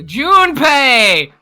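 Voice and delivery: high, loud, drawn-out wail; dramatic name cry. Acted. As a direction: belt the name out, stretched long. Cries out a character's name.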